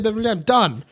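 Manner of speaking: ordinary talk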